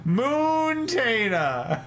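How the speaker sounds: Moontaina very drawn out, high "MOOOON-TAY-na"; playful mispronunciation. Retold or imitated. Streamer relishing Jack's joke name for Montana, playful mispronunciation. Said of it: "This is good".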